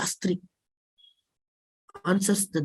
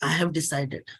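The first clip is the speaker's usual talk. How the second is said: usual speaking voice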